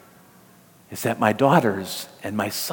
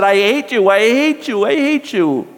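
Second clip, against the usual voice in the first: mock child tantrum, climbing higher each time; child's angry outburst; 10 semitones higher, 4 dB louder than usual. Preacher voicing a little boy's angry outburst at mom, building higher. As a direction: let the hate build, pitch rising on every repeat. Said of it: "in a moment of anger".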